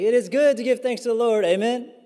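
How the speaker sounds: put-on high, loud Southern-accented call to worship; rally the congregation like a Southern worship leader; Southern praise leader. Preacher recalls his childhood praise leader opening worship, booming Southern accent. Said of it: "with his Southern accent".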